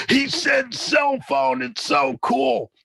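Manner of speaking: put-on high, thrilled kids shouting "cell phone", slow; excited kids. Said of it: "they were like".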